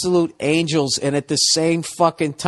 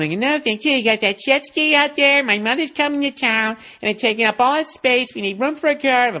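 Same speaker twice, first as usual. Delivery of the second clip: raised mock nagging wife voice, words tumbling out; mock the wife on a roll about the jet ski; imitating nagging wife; 6 semitones higher than usual. Husband imitates his wife's nagging rant, higher pitch. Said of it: "and she's going".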